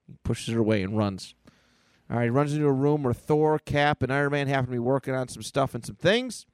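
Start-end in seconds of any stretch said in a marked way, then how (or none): none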